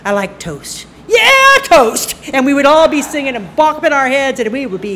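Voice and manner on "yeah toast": usual speaking voice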